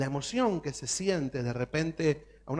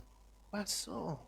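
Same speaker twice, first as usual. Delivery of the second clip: very soft and slow, almost breathed; dazed quiet; 13 dB quieter, much slower than usual. Retold or imitated. Believer wondering where the fire went, very soft and slow. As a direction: drift off, lost and puzzled. Believer after promise fades.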